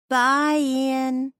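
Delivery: high, loud sing-song farewell; cheerful tipsy goodbye. A narrator's reading. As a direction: call it out while hurrying by. Waving while dragged past.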